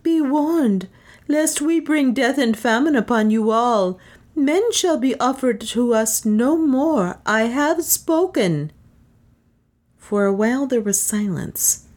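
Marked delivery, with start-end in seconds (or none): 0.0-8.7 high, slowing proclamation; solemn cried warning. A narrator's reading. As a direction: pronounce a goddess's warning, pitched high. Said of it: "she cried".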